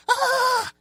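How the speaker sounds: very high "ah" scream; imitated panicked scream. Cameraman in the runaway car, imitated high scream. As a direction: shriek high like someone who lost his mind. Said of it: "he just screams again".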